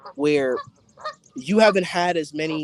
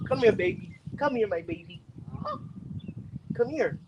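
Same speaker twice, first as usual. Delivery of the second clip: soft, higher coo to the pet; 5 semitones higher, 7 dB quieter, slower than usual. Real, not acted. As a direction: coo sweetly to the puppy. Cooing at the dog.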